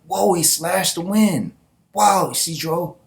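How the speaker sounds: high, awestruck youngsters; mock amazement. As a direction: marvel in a high voice like an amazed kid. Boy and mermaid girl marvel.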